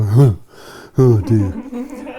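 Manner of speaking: gormless grunt then a laughing oh dear; Kevin impression. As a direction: play the slouching, sulky teen. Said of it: "So you want to do a Kevin".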